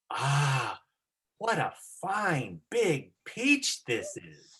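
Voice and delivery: Ah, what a fine big peach this is drawn out slowly; slow savoring. Shown as a demonstration. Teacher models the line slowly for the student. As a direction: linger over every word like in a movie. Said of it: "you can slow down when you say".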